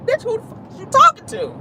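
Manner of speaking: shrill-free high-pitched indignant outburst, pushed way up; outraged high squeal. Retold or imitated. Telling the old lady off, high outraged squeal.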